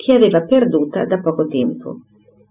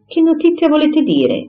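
much higher, wary query; puzzled question; 10 semitones higher than usual. A narrator's reading. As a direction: ask cautiously, fishing for what she knows. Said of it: "incapace di indovinare".

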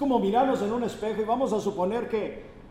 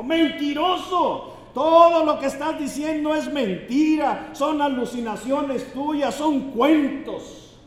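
high, loud, enraged yelling; hammed-up angry heckler yelling liar; furious shout; 7 semitones higher, 7 dB louder, slower than usual. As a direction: bellow it at the preacher like he lied to you. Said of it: "y le gritó al predicador".

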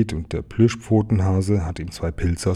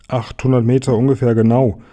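announcing; 4 semitones higher, 6 dB louder than usual. A narrator's reading. Elephant announces the distance.

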